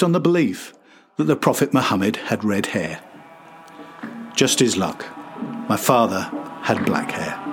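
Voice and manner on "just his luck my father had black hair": plain reading voice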